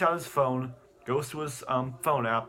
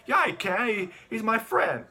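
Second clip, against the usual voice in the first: put-on high-pitched kid insisting; defiant child; 9 semitones higher, 3 dB louder than usual. Son insisting to Dad about his cop friend, high protest. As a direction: play a boy arguing back, pitched right up. Acts boy arguing with dad.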